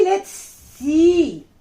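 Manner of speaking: usual speaking voice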